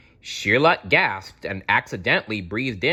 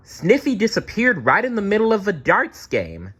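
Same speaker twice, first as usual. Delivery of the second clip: much higher, bright waitress voice, a little louder; 11 semitones higher, 4 dB louder than usual. A narrator's reading. Waitress telling the detectives Sniffy vanished, in a high voice. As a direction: pitch up for a worried waitress. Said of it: "said a waitress".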